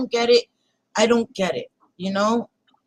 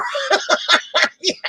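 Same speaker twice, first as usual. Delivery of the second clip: high shrieking laugh; 8 semitones higher than usual. Real, not acted. Laughs after rawr.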